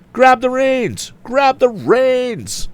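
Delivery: high, slow drawn-out shout. A narrator's reading. Tom shouting to the woman in the wagon, slow high shout. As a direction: stretch each word out as a cry. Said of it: "shouted Tom".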